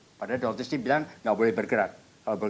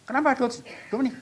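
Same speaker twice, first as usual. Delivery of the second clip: high, louder startled question; cemas bertanya; 14 semitones higher, 4 dB louder, faster than usual. Retold or imitated. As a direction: imitate an anxious question to the doctor. Bertanya cemas kepada dokter. (bertanya cemas dengan suara tinggi)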